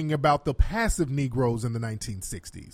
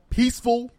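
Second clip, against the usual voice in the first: Peaceful said higher and leaned on, mocking; mock emphasis; 7 semitones higher than usual. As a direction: lean hard on Peaceful to mock his emphasis. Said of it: "he keeps emphasizing the words peaceful".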